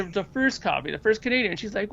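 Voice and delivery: normal talking voice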